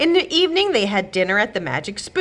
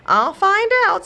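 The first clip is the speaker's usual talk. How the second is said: much higher, louder soothing husband; 13 semitones higher, 5 dB louder than usual. Acted. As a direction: soothe the grumbling wife. Husband voiced.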